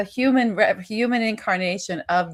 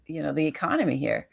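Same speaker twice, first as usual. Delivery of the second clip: ordinary talk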